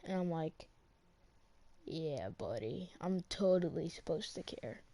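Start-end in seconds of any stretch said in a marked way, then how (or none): 1.8-4.8 low, soft, slow sarcasm; mock agreement, deadpan and quiet; sarcastic. Student sarcastically answering the teacher, low and soft.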